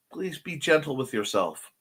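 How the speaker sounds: normal talking voice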